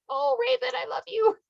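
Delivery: high sing-song Midwestern grandma, "Oh, Raven, I love you"; Midwestern grandma accent. Retold or imitated. Friend imitates another friend's adorable Midwestern accent, high and sweet. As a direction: coo it like a doting Minnesota grandma. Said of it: "so like this all the time".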